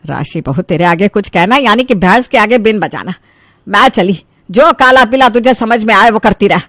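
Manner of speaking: lightly voiced exasperated elder; fed-up mother-in-law. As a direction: give up on her crossly.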